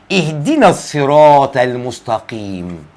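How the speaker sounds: slow, low, measured recitation; drawn-out Fatiha reading; Quran recitation. Shown as a demonstration. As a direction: recite the verse slowly with long vowels. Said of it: "لما بتقرأ الفاتحة بتقول".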